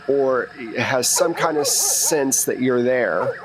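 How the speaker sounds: normal talking voice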